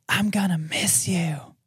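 airy eerie whisper; ghostly whisper. Pretend. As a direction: breathe it like a ghost. They believe it says.

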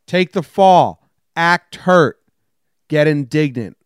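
slow, loud, lightly voiced coach barking orders; a hint of a gruff coach telling his player to take a dive; Coach Banks impression. A hint of Coach Banks coaching his player to fake injuries, slow and loud. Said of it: "It's Coach Banks".

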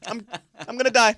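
high loud panicked whine; mock panic. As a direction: squeal like you're dying. Panicking in dentist chair.

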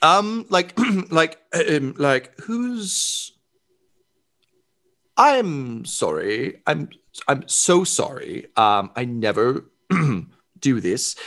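high, fussy, mock-polite Karen voice; entitled complainer. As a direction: whine for the manager. Entitled guest demanding manager.

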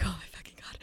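hushed, voiceless whisper of dread. Retold or imitated. Reacting as haunted house gets scarier, whispered dread. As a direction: whisper it in mock horror.

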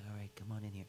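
put-on whispered welcome to a sleepless child; hush, invite her in gently; soft whisper. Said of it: "I'm like".

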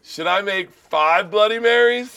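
loud and slow, called across the room; shouting the offer to everyone; drawn-out call. Real, not acted. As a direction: stretch it out like a hearty host's call. Calls out, drawn out.

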